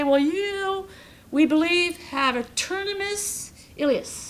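solemn doctor. Retold or imitated. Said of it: "they come in and they say".

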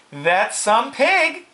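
high, slow drawn-out admiration; admiring farm wife. Acted. Farm wife admiring the pig, high slow praise.

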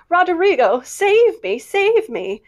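high, loud melodramatic plea; frantic cry. Acted. Jo staging the fainting scene, frantic melodramatic cry. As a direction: cry out frantically for rescue, overacted. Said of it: "crying frantically".

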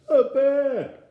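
high, very loud shriek; piercing storybook scream of fright. A narrator's reading. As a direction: scream it as a terrified small boy. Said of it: "screamed Eddie".

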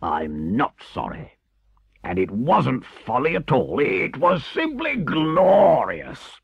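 stubborn refusal rising to ecstatic cry; defiant, building to rapture. Acted. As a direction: start sullen and slow, swell high and loud. Toad defiantly retracts his repentance.

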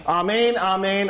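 "amen" said as ah-MAYN, both times; Hebrew pronunciation. Shown as a demonstration. Preacher quotes Jesus with the Hebrew word, "amen" pronounced ah-MAYN. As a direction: open AH opening each "amen". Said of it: "or they pronounced it amen".

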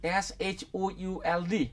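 slow letter-by-letter spelling. Retold or imitated. Retired lecturer to interviewer, spelling slowly letter by letter. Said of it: "বৰ্ডত লিখাচোন".

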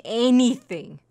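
ordinary talk

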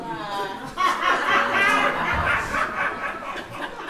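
a long, loud, high burst of laughter; real laughter. Real, not acted. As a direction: crack up at your own punchline. Laughs at his own joke.

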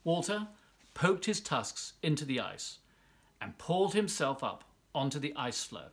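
ordinary delivery

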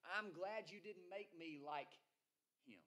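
smug Pharisee. Retold or imitated. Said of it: "The Pharisee says".

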